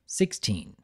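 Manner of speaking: plain reading voice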